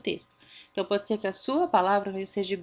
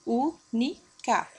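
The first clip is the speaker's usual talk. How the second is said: "única" split as ú, ni, ca; syllable by syllable; much slower than usual. Shown as a demonstration. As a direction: say "única" in separate syllables with pauses. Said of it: "A sílaba tônica é a antepenúltima".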